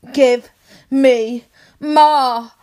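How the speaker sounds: loud, pleading cry; loud demand. Acted. Demanding during sex.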